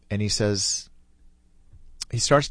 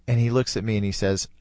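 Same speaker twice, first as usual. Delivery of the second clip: usual speaking voice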